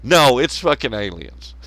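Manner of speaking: usual speaking voice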